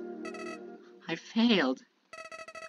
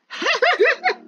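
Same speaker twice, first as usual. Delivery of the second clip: high, loud burst of mocking laughter; sudden high-pitched villain cackle; 5 dB louder than usual. Villain scoffs at its own old excuse, mocking laugh.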